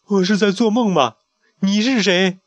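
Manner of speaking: high, anxious questioning; frightened question. Acted. Chick facing the floating pharaoh, frightened high voice. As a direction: ask in a frightened rush. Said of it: "小胖墩儿惶恐地问".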